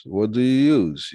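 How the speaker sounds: ordinary talk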